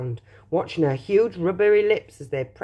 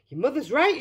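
mild shout; 7 semitones higher than usual. A narrator's reading. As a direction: call out as an alarmed father. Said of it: "shouted Mister Beauregard".

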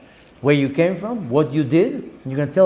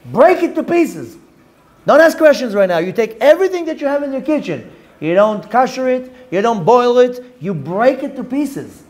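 stern decree; 7 semitones higher than usual. Acted. Rabbi decrees smashing all dishes.

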